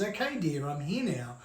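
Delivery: put-on soft, unhurried old man soothing her; calm her down gently like nothing is wrong; cool and calm. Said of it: "like, all cool and calm".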